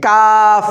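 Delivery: কাফ pronounced loud, tongue further forward on the palate; Arabic letter kaf. Shown as a demonstration. Teacher demonstrating kaf to adult learners, loudly. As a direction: hit কাফ cleanly off the palate. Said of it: "জিহ্বার আগা থেকে একটু আগে".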